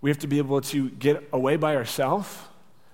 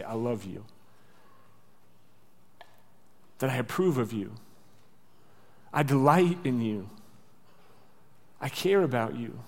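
tender reassurance; 3 semitones lower, 7 dB quieter than usual. Retold or imitated. God reassuring people afraid he will yell, tenderly. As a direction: lower and softer, gentle assurance. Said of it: "he's gonna say".

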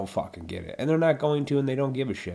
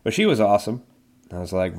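usual speaking voice

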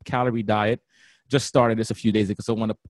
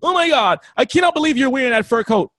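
high, loud mock outrage; hater vegan; 12 semitones higher, 6 dB louder than usual. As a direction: ham up an appalled zealot. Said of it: "They go to that level like".

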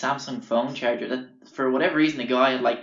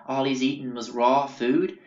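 ordinary talk, nothing marked

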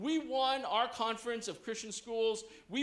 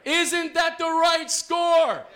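very loud, high, slow cheer; cheerleader shout; 9 semitones higher, 11 dB louder, slower than usual. Shown as a demonstration. Playing cheerleader, leads the audience in response, shouted chant. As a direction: bellow it slowly so the crowd answers. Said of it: "I'll be the cheerleader".

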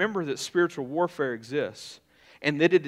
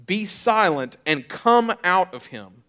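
slow, louder, higher commanding rebuke; stern rebuke; 5 semitones higher, 8 dB louder, slower than usual. A narrator's reading. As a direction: order the spirit out, firm and slow. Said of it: "Jesus rebuked him".

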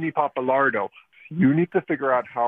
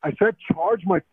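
rising, indignant, higher-pitched protest; entitled customer; 7 semitones higher than usual. Real, not acted. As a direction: argue the bill, voice climbing higher. Angry caller disputes the charge.

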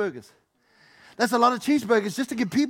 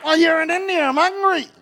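much higher, louder teasing voice; the wife teasing from India; voicing the wife; 8 semitones higher, 8 dB louder than usual.

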